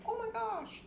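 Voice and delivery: mock despair at the stuck cat; exasperated oh my gosh. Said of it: "I'm just like".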